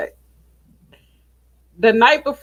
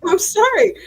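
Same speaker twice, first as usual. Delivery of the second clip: embarrassed apology; 8 semitones higher than usual. Retold or imitated. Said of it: "And I'm like".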